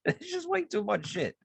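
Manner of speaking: laughing through the words. Real, not acted.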